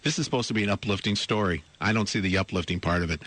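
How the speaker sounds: ordinary talk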